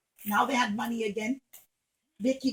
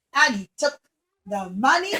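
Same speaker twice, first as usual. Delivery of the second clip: ordinary delivery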